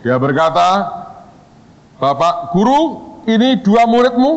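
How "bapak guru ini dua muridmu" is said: slow start, rising high; pitched-up theatrical courtroom question; acted stern questioner. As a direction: address the teacher with mock authority. Boss questions the lecturer.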